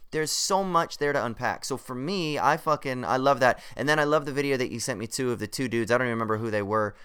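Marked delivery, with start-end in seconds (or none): none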